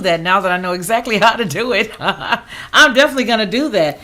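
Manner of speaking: chuckling through the words; laughing. Real, not acted. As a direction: laugh while you say it. Laughs while talking.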